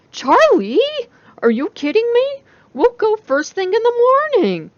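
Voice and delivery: overjoyed at the request; thrilled friend. A narrator's reading. Jessica thrilled.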